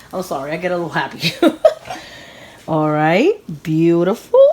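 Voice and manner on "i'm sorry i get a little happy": normal talking voice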